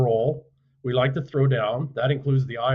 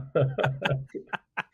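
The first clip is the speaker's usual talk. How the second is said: a short burst of real laughter; hearty laugh at the crayon joke; 3 semitones higher, 3 dB quieter than usual. Real, not acted.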